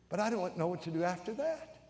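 ordinary talk, nothing marked

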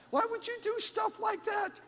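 very high, squeaky incredulity; mock disbelief. Preacher to online complainers about their posts, in squeaky mock disbelief. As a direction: question them in an exaggerated high voice.

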